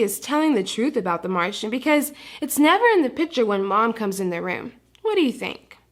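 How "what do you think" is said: usual speaking voice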